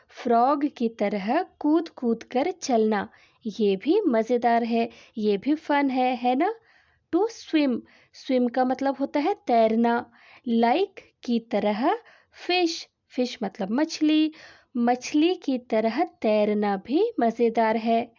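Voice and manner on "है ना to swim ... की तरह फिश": ordinary talk, nothing marked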